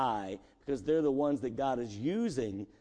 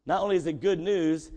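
usual speaking voice

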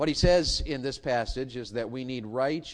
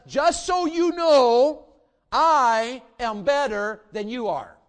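much higher, louder, slow mock-boast; a hammed-up Paul bragging, stretched out; boastful Paul; 8 semitones higher, 9 dB louder, much slower than usual. Paul bragging to the church, a slow loud mock-boast. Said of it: "Paul says".

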